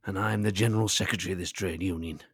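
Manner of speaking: a hint of a union general secretary; union boss. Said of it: "Maybe I was channeling one of our listeners".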